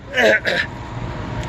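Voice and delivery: food down the wrong pipe cough; hacking cough on a crumb; choking cough. Real, not acted. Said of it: "Piece of hamburger went down the wrong pipe".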